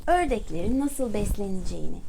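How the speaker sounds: ordinary delivery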